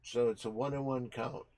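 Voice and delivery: sportscaster voice calling the pitches; playful baseball announcer; mock play-by-play. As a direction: call each pitch like a live broadcast.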